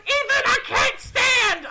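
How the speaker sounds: very high, drawn-out mock wail; panicked wail. Rachel hanging on her brother, panicked wail. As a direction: mimic a helpless sister wailing. Sister wailing, imitated.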